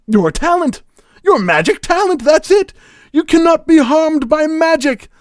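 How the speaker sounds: very high, loud exclamations; excited realisation. Acted. Magician realising his rival's magic talent, excited shouting. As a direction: burst out with a grand theatrical revelation. Villain has a sudden realisation.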